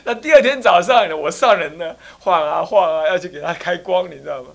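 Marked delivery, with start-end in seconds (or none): none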